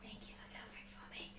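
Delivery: breathy unvoiced whisper. Real, not acted. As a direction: whisper it as a quick aside. Aside to another player.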